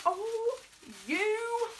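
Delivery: high and loud, squealing with joy; high squeal of thanks to a friend; overwhelmed delight. Real, not acted. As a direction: squeal it out, deeply touched. Touched by a friend's gift.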